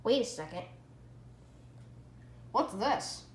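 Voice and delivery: usual speaking voice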